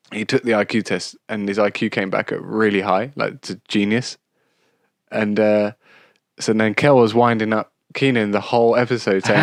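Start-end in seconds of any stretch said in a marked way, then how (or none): none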